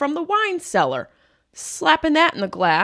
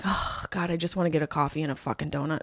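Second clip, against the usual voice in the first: weary mutter; 5 semitones lower, 9 dB quieter, faster than usual. Retold or imitated. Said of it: "And I'm like".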